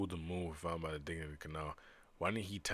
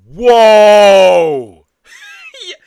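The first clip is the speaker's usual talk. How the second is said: much higher and louder, long held whoa; astonished shout; 30 dB louder than usual. Real, not acted. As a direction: blurt out in shock. Sudden surprise at game event.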